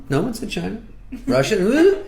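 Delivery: high sly questioning hmm; coy rising tease. Acted. Commander hinting at the enemy, coy rising tease. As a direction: tease it, voice climbing. Officer coyly teasing the enemy.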